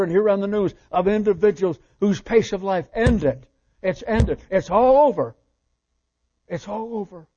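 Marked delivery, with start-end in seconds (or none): 4.5-5.4 loud declaration. Acted. Preacher repeats line for emphasis. 6.5-7.3 softer, falling and final; quiet echo. Acted. Same words repeated, pulled back.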